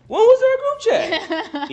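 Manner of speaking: high, slow, incredulous squeak; mock disbelief. Hammed-up surprised question about the group chat. Retold surprise at being left out.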